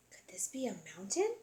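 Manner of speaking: hushed whisper of wonder; small bear whispering at the escalator; awed whisper. Acted. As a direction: breathe it like a secret discovery.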